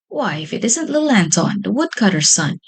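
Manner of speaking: usual speaking voice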